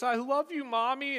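put-on high, pleading kid voice; mock-upset little one; imitated little child. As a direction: plead to Mommy like a small child. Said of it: "and he goes".

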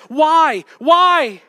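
loud, high shouted question; shouted why. Real, not acted. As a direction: hurl the question at the crowd. Preacher shouting rhetorical question.

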